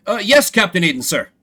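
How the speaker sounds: Larry answering Captain Aiden; eager underling. Acted. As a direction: snap to attention.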